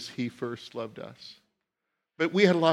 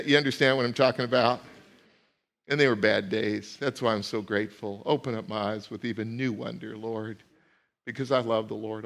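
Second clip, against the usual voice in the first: ordinary delivery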